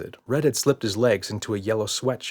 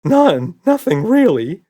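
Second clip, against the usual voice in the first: much higher, louder protest; eager denial; 10 semitones higher, 11 dB louder, slower than usual. A narrator's reading. Red denies any harm to Roger, high and loud. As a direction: blurt it innocently.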